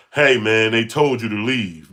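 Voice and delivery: booming mock bystander telling you to leave. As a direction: bark it like a pushy stranger.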